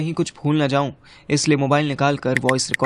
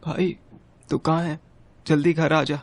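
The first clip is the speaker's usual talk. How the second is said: tearful, slightly raised pitch; 2 semitones higher than usual. Acted. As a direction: call a friend home through tears. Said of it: "तू रो क्यों रहा है?". (रुआँसी आवाज़ में)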